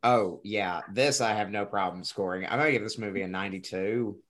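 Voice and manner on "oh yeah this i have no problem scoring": ordinary delivery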